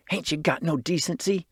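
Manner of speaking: plain reading voice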